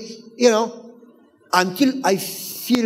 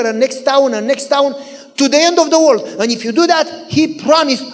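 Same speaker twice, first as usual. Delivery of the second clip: raised, higher, challenging sermon voice; forceful preaching; 4 semitones higher, 4 dB louder than usual. Real, not acted. Preacher commanding church to love neighbors, forceful. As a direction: press the congregation with insistent questions and commands.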